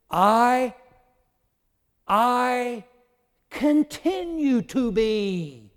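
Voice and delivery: loud, high, drawn-out declaration; slow, ringing cry as the apostle; very emphatic. Acted. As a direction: hold each "I" long, with big pauses. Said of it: "he used the present tense".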